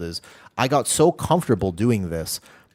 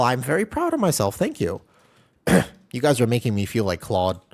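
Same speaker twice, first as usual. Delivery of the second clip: usual speaking voice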